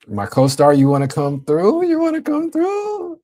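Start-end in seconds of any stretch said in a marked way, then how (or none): 1.9-3.2 much higher, slow, put-on voice; sing-song falsetto invite. Streamer re-invites co-star in a playful falsetto. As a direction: croon the invite up high and slow.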